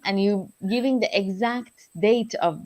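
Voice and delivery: usual speaking voice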